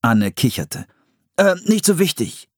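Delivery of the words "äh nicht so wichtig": high, flustered dismissal; hasty brush-off. A narrator's reading. Brother covering for his sister, hasty. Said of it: "sagte Philipp schnell".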